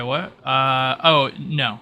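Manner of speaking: usual speaking voice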